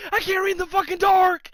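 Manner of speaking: loud, high-pitched screaming tantrum; raging mailman. Retold or imitated. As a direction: imitate a mailman screaming in helpless rage. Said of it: "hear a mailman raging".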